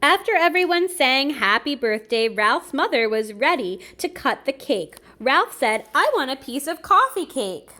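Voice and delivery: put-on lively read-aloud for children; storybook reading. A narrator's reading. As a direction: read it like a bedtime picture book. Reading a picture book aloud.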